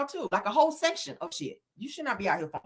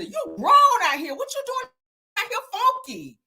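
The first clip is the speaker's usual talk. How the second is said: in-character shout; 9 semitones higher than usual. Pretend. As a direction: shout it at him, fully in character. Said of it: "Let me get back into character".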